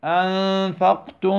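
أنفقتم من with meem merged and held nasal, slow; idgham demo. Shown as a demonstration. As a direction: stretch ما أنفقتم into من. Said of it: "انظر". (إدغام شفوي بغنة)

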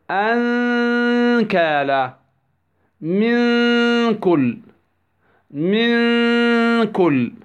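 أنكالا, من كل, إذا كرة very slow, each n a long nasal hum; ikhfa before kaf. Shown as a demonstration. Tajweed teacher gives examples, long humming ikhfa in أنكالا, من كل, إذا كرة. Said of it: "وهكذا في بقية ماذا؟ الأحرف يكون ماذا؟ الإخفاء".

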